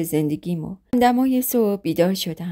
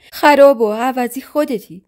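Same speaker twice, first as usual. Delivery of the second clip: loud, higher furious insult; angry outburst; 5 semitones higher, 6 dB louder than usual. Acted. Narrator lunging at her rival wife, furious shout. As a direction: spit the insult back at her.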